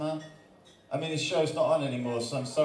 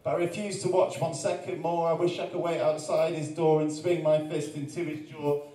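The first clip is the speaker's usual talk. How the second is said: rhythmic spoken-word delivery, punchy and building; performed poem; 5 semitones higher, 3 dB louder than usual. Acted. Poet reciting his Jeremy Kyle poem to the crowd, rhythmic and punchy. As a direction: recite it like a slam poet working the room.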